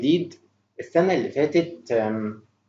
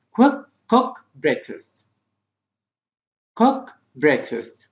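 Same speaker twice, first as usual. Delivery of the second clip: slow, word-by-word modelling; slow model reading; much slower than usual. Shown as a demonstration. Teacher modelling a phrase for pupils to repeat, slowly. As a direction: say it slowly with gaps for learners. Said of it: "Try to repeat after me".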